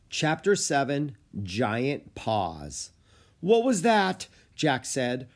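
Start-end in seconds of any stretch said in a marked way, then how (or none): 3.4-4.3 high, loud startled question; frightened. A narrator's reading. Boy reacts to a piercing scream, frightened. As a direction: blurt it out in alarm.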